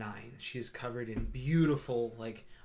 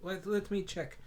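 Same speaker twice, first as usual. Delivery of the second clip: higher, bright-pitched welcoming shop cat; Moon the Tabaxi shopkeeper; 7 semitones higher than usual. Acted. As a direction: greet customers grandly in a high voice. Moon replies to customer.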